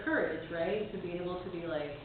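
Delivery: usual speaking voice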